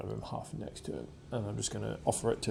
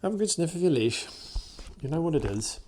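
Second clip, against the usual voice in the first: higher, louder coaxing voice to a nervous dog; 7 semitones higher, 4 dB louder than usual. Real, not acted. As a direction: invite the dog kindly to sniff.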